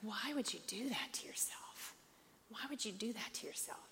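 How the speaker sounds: hushed whisper of disbelief. Retold or imitated. Whispering her shock at the actress's face. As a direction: breathe it out, barely voiced, aghast. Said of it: "you're like".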